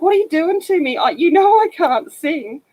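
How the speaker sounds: high, pleading protest at a friend; mock pleading to a friend. Protesting to the musical director friend, high and pleading. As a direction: plead with the friend, pitched well up. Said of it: "and I'm like".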